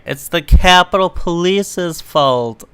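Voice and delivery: normal talking voice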